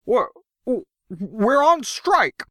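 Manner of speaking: high, halting, cringing voice; nervous announcement. A narrator's reading. Cringing Crockett facing the emperor, nervous announcement. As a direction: squeak it out timidly, stumbling on the start. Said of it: "he announced".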